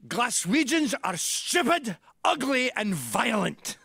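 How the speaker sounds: slow, high, loud bark as the Scottish dad. Retold or imitated. Dad insulting Glaswegians in Cuba, a loud imitated bark. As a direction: bellow each insult, drawn out. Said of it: "he barked out".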